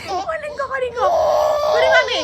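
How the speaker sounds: high, loud wailing; screaming cry. Real, not acted. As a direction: shriek it through sobs. Child wailing to grab it.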